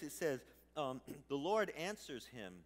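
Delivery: usual speaking voice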